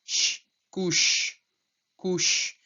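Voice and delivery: couche ending on a long sh; French stem demo. Shown as a demonstration. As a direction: hold the final sh of couche. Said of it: "som no final".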